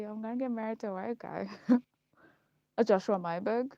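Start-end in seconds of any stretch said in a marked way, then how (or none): 1.7-3.7 laughing. Real, not acted. Laughing about teen crush.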